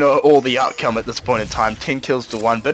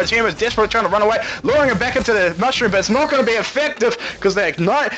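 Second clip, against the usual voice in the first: raised-pitch chase commentary; excited play-by-play; 7 semitones higher than usual. Acted. Caster calling Jax's chase of Teemo, high and excited. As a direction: build the excitement as the hunt closes in.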